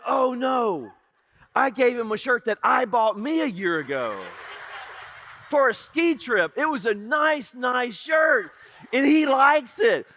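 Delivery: sudden horrified realization, pushed out loud; alarmed outburst. Retold or imitated. Uncle panicking after gifting his own nice shirt. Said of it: "I was like".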